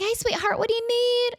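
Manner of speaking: high, sugary kind voice; imitating a sweet pop star. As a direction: greet the nervous guest sweetly. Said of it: "she's so sweet".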